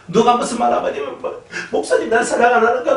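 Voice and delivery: high, whining mock complaint; whiny congregant. Pastor mocks a sulking member, high whine. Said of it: "막 징징거리고".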